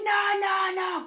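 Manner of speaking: very high imitated protest; going mental. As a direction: refuse to hear it as a frantic guard. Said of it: "going mental".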